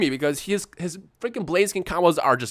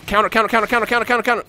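higher, urgent repeated counter; frantic calling; 8 semitones higher, 4 dB louder, faster than usual. Real, not acted. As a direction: call each counter frantically.

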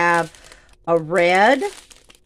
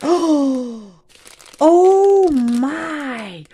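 gasping in delight, higher and louder; awed gasp; 6 semitones higher, 4 dB louder, much slower than usual. Real, not acted. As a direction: gasp and marvel at the shine. Gasping at iridescent crystal.

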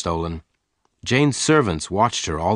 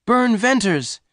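very high-pitched exclamation; astonished cry; 14 semitones higher, 3 dB louder, slower than usual. A narrator's reading. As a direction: cry out the name in amazed recognition. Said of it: "Jane gave a cry".

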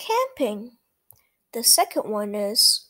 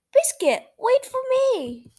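higher, louder calling out; calling after; 12 semitones higher, 3 dB louder than usual. Acted. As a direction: call after the runaway puppy. Owner calling after puppy.

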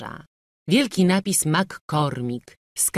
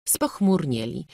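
ordinary delivery

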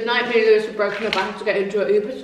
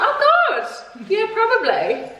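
embarrassed laugh; 11 semitones higher, much slower than usual. Retold or imitated. Her own embarrassed reply quoted.

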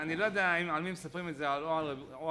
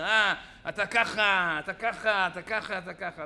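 loud, hammered finger-pointing accusations; accusatory rebuke; 8 dB louder than usual. Acted. A harsh preacher singles out listeners, accusatory rebuke. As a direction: scold them one accusation after another. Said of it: "לא בא ואומר".

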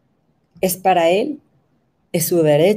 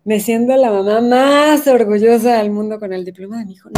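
louder, gushing show-off mother; mock proud mom; 4 semitones higher, 5 dB louder than usual. Mocking moms posting their kids' diplomas, gushing. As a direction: brag like a Facebook mom. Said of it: "Todas las mamás poniendo los diplomas".

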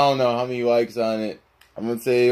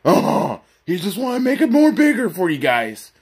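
throaty talking-dog voice, higher pitch; Scooby-Doo; 4 semitones higher than usual. Acted. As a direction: chime in like a cartoon dog. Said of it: "Shaggy out and Scooby's out".

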